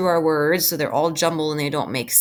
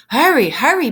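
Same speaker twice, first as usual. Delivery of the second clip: 1 urgent call; 7 semitones higher, 6 dB louder than usual. Acted. Read-aloud character urging.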